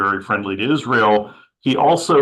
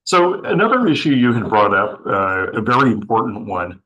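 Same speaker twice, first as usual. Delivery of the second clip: hoarse, strained voice; losing voice; 3 dB louder than usual. Real, not acted. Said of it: "I'm kind of losing my voice this morning".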